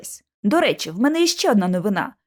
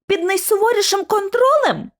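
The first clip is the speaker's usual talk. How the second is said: scoffing question pitched much higher; mocking echo; 8 semitones higher than usual. George mocking Lucy's claim, scornful high echo. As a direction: throw her words back at her in disbelief.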